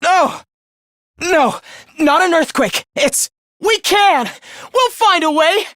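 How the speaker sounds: high, desperate denial; high-pitched dismay; alarmed protest. Acted. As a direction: plead against it in rising alarm.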